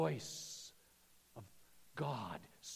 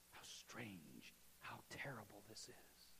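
low, much softer grieving tone; hushed lament; 7 semitones lower, 15 dB quieter than usual. Real, not acted. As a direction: let it sink into sorrow. Lament over the murder of Jesus.

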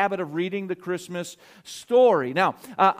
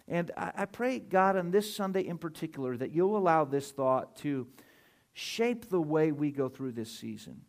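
prayer; 4 semitones lower, 5 dB quieter than usual. Acted. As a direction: pray quietly and humbly before the sermon. Pastor leads opening prayer.

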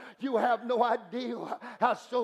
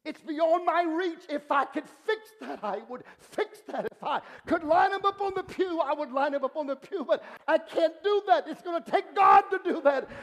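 high, pleading preacher's cry growing louder; impassioned preaching; 6 semitones higher, 3 dB louder than usual. Acted. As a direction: cry it out to the congregation, building. Preaching rises to full force.

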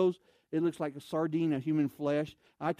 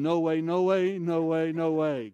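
mock shocked; 4 dB louder, slower than usual. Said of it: "And I'm going".

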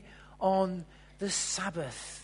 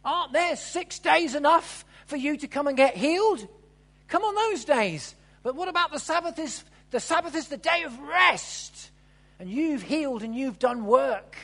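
higher and louder telling-off; indignant scolding; 6 semitones higher, 8 dB louder than usual. Acted. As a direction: scold the crowd as the angry leader. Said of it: "will be saying to you".

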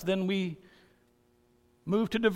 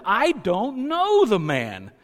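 loud, slow, higher and emphatic; cursing denial; 6 semitones higher, 8 dB louder, much slower than usual. Shown as a demonstration. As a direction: hurl it out like a curse. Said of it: "call down curses on himself".

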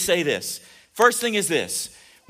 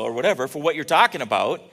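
normal talking voice

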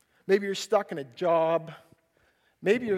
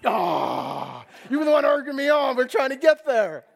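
exasperated outburst; 5 semitones higher, 8 dB louder than usual. Retold or imitated. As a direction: groan bursting into loud, high, fast protest. Snapping back at friend's sarcasm.

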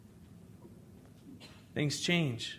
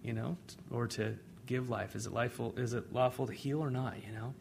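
quiet; 4 semitones lower, 8 dB quieter than usual. Retold or imitated. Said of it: "He says".

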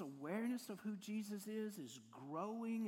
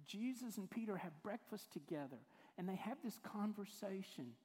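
ordinary talk, nothing marked

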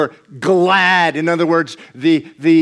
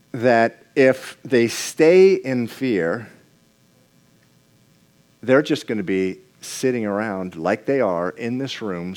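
ordinary delivery

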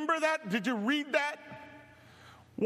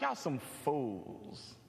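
low, slow, softer mock scolding of foolish disciples; Jesus scolding; 10 semitones lower, 7 dB quieter, much slower than usual. Preacher voicing Jesus to the disciples, low slow scolding. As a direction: drawl it out low like a weary teacher. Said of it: "Jesus is like".

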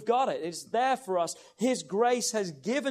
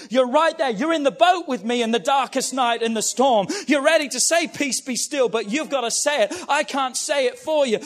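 loud, pitched-up preaching climax; fiery preaching; 3 semitones higher, 6 dB louder than usual. Acted. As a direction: lift the room with every line. Preaching at full force.

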